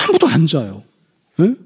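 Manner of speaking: ordinary talk, nothing marked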